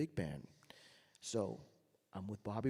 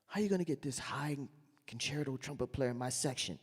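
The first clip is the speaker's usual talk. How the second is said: higher, louder indignant rant; mocking angry complaint; 7 semitones higher, 5 dB louder than usual. Bandleader complains to Bobby about the new trumpeter, high indignant rant. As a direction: ham up a furious bandleader griping. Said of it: "He says".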